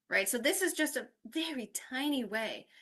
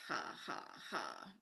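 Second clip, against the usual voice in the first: low, quiet, dry "ha, ha, ha"; mock laughter at a lame pun, very subdued; deadpan fake laugh; 14 semitones lower, 13 dB quieter than usual. Shown as a demonstration. Teacher after reading a police pun to colleagues, deadpan.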